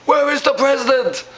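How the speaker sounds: high-pitched hammy villain yell; mock yelling. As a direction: yell it out, overacting an enraged movie villain. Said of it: "he's either yelling really loud".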